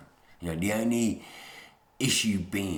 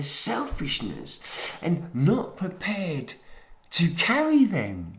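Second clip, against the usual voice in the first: heated rant; 9 semitones higher, 5 dB louder than usual. Real, not acted. Ranting about older generations.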